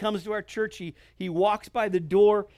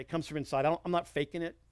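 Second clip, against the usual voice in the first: ordinary delivery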